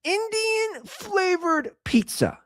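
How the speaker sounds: hammed-up disbelief on the repeat; incredulous repeat. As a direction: drag it out in mock horror. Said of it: "Let me repeat that".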